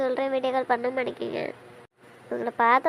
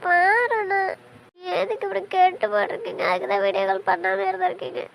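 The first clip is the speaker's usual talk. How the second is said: tearful; 3 semitones higher than usual. Acted. Wife crying at her bandaged husband on the video call, tearful and pleading. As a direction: plead while sobbing, voice raised. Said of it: "அழாத பிரியா".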